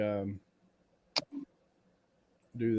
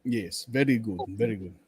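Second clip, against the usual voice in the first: slow, deliberate put-on German accent; mock German accent; 3 semitones lower than usual. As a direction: play a tipsy, bad German, taking your time. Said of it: "I turn to German".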